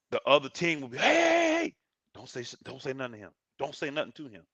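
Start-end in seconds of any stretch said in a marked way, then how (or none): 1.0-1.7 high, drawn-out "hey" to cut someone off; stretched, high-pitched shout of warning; alarmed call-out. Retold or imitated. Other team calling out in alarm. 2.1-4.4 urgent hushing. Retold or imitated. Other team hushing a teammate.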